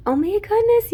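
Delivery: high and loud burst of delight; excited gasp. Real, not acted. Reader thrilled by the spread, high excited exclaim. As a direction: let it fly up, thrilled. Reacting to the cards.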